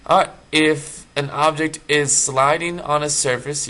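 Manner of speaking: ordinary talk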